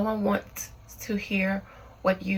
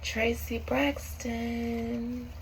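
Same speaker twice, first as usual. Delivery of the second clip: summoning a spirit; 5 dB quieter than usual. Acted.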